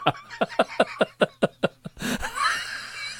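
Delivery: long, high squealing laugh; real high-pitched wheezing giggle fit; helpless laughter. Real, not acted. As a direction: laugh till it squeaks and trails away. Helpless laughter at own joke.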